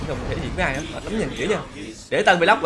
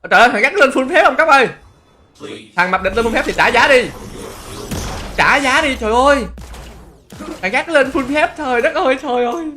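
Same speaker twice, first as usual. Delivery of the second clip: high, loud cries of dismay; alarmed exclamation; 6 semitones higher, 5 dB louder than usual. Real, not acted. Streamer sees the rival at full build, alarmed exclamation.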